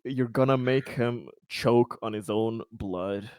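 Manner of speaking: ordinary talk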